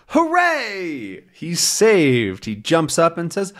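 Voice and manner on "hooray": cheering. A narrator's reading. Narrator cheers rescue.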